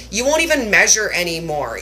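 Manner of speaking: normal talking voice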